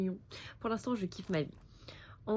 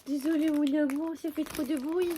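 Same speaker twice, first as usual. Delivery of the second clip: higher, sweet pet voice; 7 semitones higher than usual. Real, not acted. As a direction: coo an apology to the dog. Apologises to dog in baby talk.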